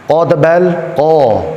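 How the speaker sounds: KO heavy and rounded; thick letter. Shown as a demonstration. Teacher drilling the alphabet, thick Ko. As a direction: say Ko thick, toward an "o".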